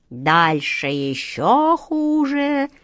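very slow, high keening; lamenting wail. A narrator's reading. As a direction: draw out the doom like a mourner. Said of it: "запричитала гадалка". (гадалка причитает, пугая Жана)

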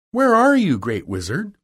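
high, loud opening call; Dorothy calling out. Acted. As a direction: call out to someone hidden. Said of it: "At last Dorothy asked".

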